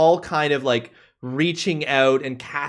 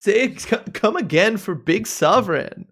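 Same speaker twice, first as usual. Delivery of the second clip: surfer bro voice; 6 semitones higher than usual. Acted. Sovereign asks to repeat.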